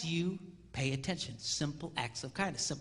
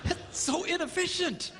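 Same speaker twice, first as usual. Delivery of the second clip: high mock complaint; a guy balking at the cost; disgruntled guy; 11 semitones higher than usual. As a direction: whine like a penny-pinching husband. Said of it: "a guy looks at that and goes".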